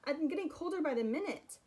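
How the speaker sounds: high, loud plea; trapped and cold, calling through the door. Acted. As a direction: keep calling for someone to hear. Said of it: "he called".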